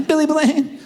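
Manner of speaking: a hint of a kid; high mock answer.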